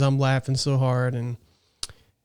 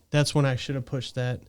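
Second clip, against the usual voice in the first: ordinary delivery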